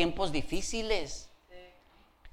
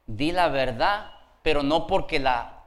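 usual speaking voice

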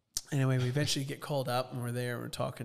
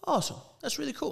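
much higher, bubbly shop-clerk voice; cheerful clerk; 5 dB louder, much faster than usual. Retold or imitated. Host imitates the Apple store clerk, very high and cheerful. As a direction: chirp it like an overly cheerful clerk. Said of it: "they're always so cheerful".

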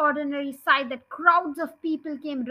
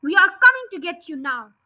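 taunting yell; 3 semitones higher, 7 dB louder, faster than usual. Acted. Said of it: "yelled Bounce".

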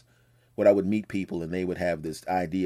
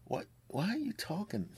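incredulous onlookers; 11 semitones higher, 9 dB quieter than usual. Retold or imitated. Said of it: "giving you their look like".

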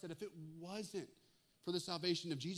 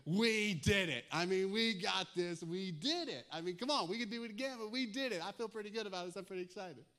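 loud, breathless new father after the birth; proud new dad; 3 semitones higher, 5 dB louder than usual. Pretend. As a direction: celebrate like you just made it through. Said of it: "I'm a little emotional".

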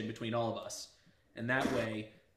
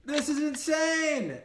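amazed; 12 semitones higher, 5 dB louder, slower than usual. Real, not acted. Reacting with amazement to the stream.

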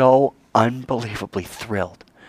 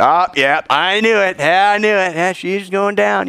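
higher, louder smug told-you-so; mock gloating; 8 semitones higher, 3 dB louder than usual. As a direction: gloat like a gossiping crowd. Townspeople gloating at the woman.

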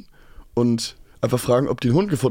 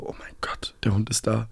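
nachgespielter Stoßseufzer, fast tonlos; geflüsterte Erleichterung; 4 semitones lower, 9 dB quieter than usual. Retold or imitated. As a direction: den erleichterten Gedanken leise hauchen. Spielt seine Erleichterung leise nach. (erleichtert geflüstert, tief und leise)

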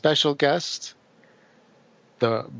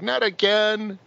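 high movie quote; 9 semitones higher, slower than usual. Retold or imitated. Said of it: "Doesn't he go like".

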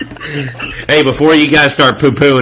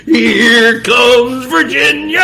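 drawn-out mock announcer call, higher; 10 semitones higher, much slower than usual. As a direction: stretch it out like a stadium announcer.

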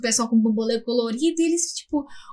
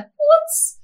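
disgusted exclamation; 7 dB louder than usual. Real, not acted. Disgust at dirty nose.

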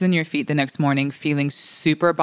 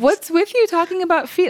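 pitched up, teasing disbelief; high teasing; 12 semitones higher than usual. Real, not acted. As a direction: tease her, voice jumping high. Teasing friend about feet.

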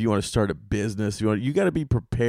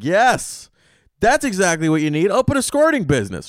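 eager advice; 8 semitones higher, 4 dB louder than usual. Retold or imitated. His eager reply to friend.

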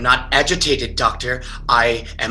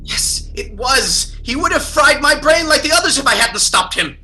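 high, desperate cry; pleading outburst, nearly shouting; 11 semitones higher than usual. Acted. Patient insisting it was self-defense.

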